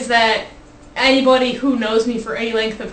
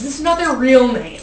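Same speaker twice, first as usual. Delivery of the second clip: ordinary talk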